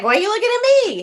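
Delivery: high, fast imitated protest; mock startled protest. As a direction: act out someone suddenly put on the spot. Ronnie reacts to everyone's stares.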